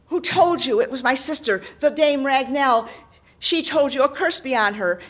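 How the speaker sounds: loud, bitter outburst; furious knight. Acted. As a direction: curse the sister loudly. Knight character voice.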